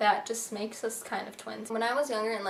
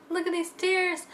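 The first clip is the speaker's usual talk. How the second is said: emotional; 8 semitones higher than usual. Real, not acted. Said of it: "I'm getting emotional".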